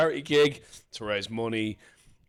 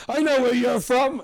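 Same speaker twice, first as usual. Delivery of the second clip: loud, high comic shout; hammed-up accusing shout; mock outburst; 12 semitones higher, 8 dB louder than usual. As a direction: blurt it out high and loud at the punchline. Acted crowd-work jibe shouted.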